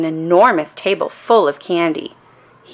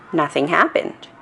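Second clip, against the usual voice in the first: ordinary delivery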